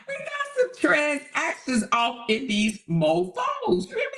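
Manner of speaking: high and slow, wheezing with laughter; laugh-crying. Real, not acted. Reacting to chat names, laugh-crying. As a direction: barely get it out through laughing.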